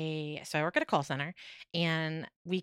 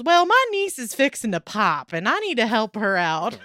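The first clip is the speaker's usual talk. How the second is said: put-on old Southern gentleman; 3 semitones higher, 9 dB louder than usual. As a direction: imitate an old Southern man, loud and earnest. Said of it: "very old Southern gentleman goes".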